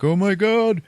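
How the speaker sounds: high, drawn-out alarmed cry; mock horror. Priest spotting the flying ball, in high mock horror. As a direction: cry out high in hammed-up shock.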